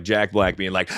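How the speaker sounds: usual speaking voice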